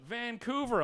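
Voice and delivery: high-pitched imitated heckler shout; mild mock yell. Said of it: "the guy yells out".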